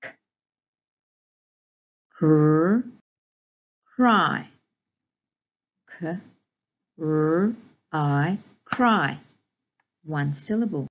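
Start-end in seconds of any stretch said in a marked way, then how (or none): 2.1-4.5 loud cr, then blended into cry; sounding out phonics. Shown as a demonstration. Teacher modelling segmenting, loud separate sounds. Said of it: "sound out each of these graphemes". 6.0-9.2 K, r, I each said alone, then cry; sounding out phonics. Shown as a demonstration. Teacher modelling segmenting, loud separate sounds. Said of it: "sound out each of these graphemes".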